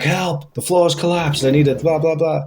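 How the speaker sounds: high-pitched, hammed-up plea; mock cry for help. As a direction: call up for help as a hurt man, half-joking. Trapped fireman calling for help.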